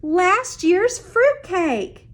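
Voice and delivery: proudly presenting the dreaded fruitcake; Miss Housett. Acted.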